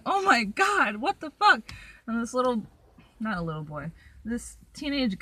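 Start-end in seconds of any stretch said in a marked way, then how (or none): 0.0-1.6 loud, high panicked yell, acted out; high, loud mock-panic outburst; retold screaming. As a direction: re-enact a startled scream. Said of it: "I started screaming".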